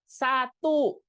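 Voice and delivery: high, loud Satu sung out in two beats as sa-tu; counting aloud. Shown as a demonstration. Teacher counting in Indonesian for parents, loud and high. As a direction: call out Satu in two clear syllables. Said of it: "Tapi begitu kita ngitung bahasa Indonesia, coba".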